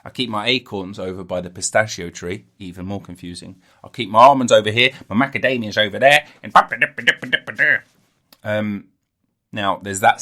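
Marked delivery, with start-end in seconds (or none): 3.8-6.3 squirrel voice. Pretend. Voicing a squirrel.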